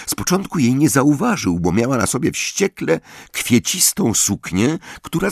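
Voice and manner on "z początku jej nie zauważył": ordinary delivery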